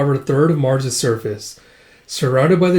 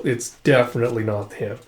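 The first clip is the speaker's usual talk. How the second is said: ordinary talk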